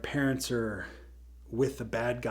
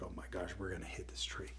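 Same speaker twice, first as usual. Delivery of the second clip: fast, frightened whisper; a rushed, soft, fearful whisper; scared whisper; 6 semitones lower, 14 dB quieter, faster than usual. Acted. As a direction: hush it out in fast panic. Tim scared of the tree.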